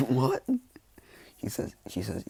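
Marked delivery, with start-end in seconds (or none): 0.1-0.4 higher, louder startled burst; shocked spit-take. Acted. Father spitting out tea at news, shocked. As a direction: blurt it out as if spraying tea.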